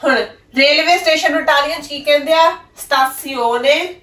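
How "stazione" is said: ਸਟਾਸੀਓਨੇ drawn out slowly, rising at the end; slow "stazione" model. Shown as a demonstration. Teacher gives the Italian for railway station, ਸਟਾਸੀਓਨੇ said slowly. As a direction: stretch ਸਟਾਸੀਓਨੇ for repetition. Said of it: "ਮੇਰੇ ਨਾਲ ਬੋਲੋ ਸਾਰੇ".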